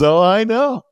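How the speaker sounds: high, knowing quip in the teacher's voice; teacher's dry reply. Retold or imitated. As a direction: toss it off high as the wry teacher. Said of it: "And he goes".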